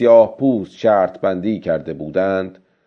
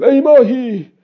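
put-on high-pitched old fisherman calling to the fish; much higher character voice, calling out; calling out loud; 12 semitones higher than usual. A narrator's reading. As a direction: raise the voice high and address the fish aloud. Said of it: "با صدای بلند گفت".

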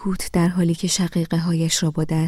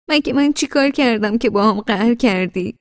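hurt demand; 5 semitones higher, 6 dB louder than usual. A narrator's reading. Wife demanding why he's sulking.

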